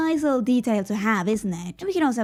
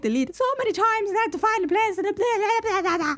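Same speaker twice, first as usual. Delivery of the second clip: exasperated rant; 9 semitones higher than usual. Real, not acted. Ranting about tedious clicking.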